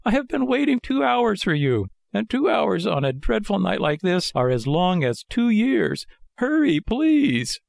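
high, pleading puppet voice; high-pitched, desperate Pinocchio; crying out. A narrator's reading. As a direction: plead upward, drawing out the last words. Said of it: "cried Pinocchio from the street".

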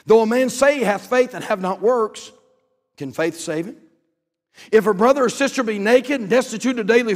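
high, loud scripture proclaimed; scripture read with preaching force. Acted. Pastor reading James to the church, loud and high. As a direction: proclaim the verse full voice. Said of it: "says it like this".

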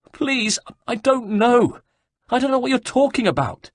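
high, pleading monk voice; desperate pleading. Acted. Beaten monk pleads with the guards, high and desperate. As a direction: beg for mercy through the pain.

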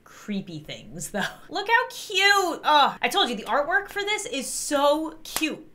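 usual speaking voice

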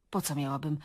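soft, low dismissal of the accusation; lekceważące zaprzeczenie. Acted. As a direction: drop the voice, dismiss it quietly. Podejrzana lekceważy oskarżenie. (cicho, lekceważąco)

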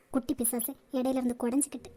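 low, soft grumble; irritated aside. Acted. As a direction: grumble under your breath about a pest. Irritated aside about the youngster.

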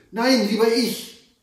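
high, insistent cry to be picked; mock yell. A narrator's reading. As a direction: cry out over your sister, pitched high. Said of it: "schrie die Zweite". (hoher, drängender Aufschrei)

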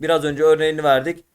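normal talking voice

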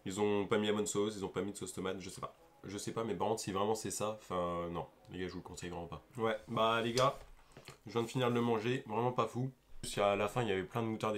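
speaking with food in mouth; mid-meal casual talk; chewing while talking. Real, not acted. Seen 2: Bites wrap at -1.0, talks with food in mouth, wrap in hand.

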